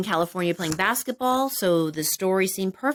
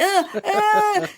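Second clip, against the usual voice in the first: panicked laugh; 12 semitones higher, 4 dB louder than usual. Retold or imitated. Wiping drops off, panicked laugh.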